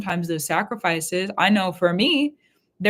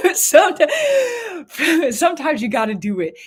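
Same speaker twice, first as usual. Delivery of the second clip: laughing; 10 semitones higher, 7 dB louder, slower than usual. Real, not acted. Breaks into laughter mid-sentence.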